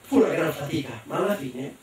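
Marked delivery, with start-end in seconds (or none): none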